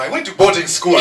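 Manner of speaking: light Nigerian accent. Shown as a demonstration. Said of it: "say in the Nigerian accent".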